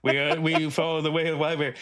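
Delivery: devoted follower in a hammed-up foreign accent; mock accent of a Brazilian devotee; put-on foreign accent. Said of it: "incorporating this accent".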